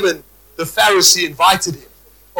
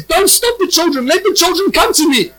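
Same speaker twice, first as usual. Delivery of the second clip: voicing the Lord; 8 semitones higher than usual. Preacher voices the Lord gently.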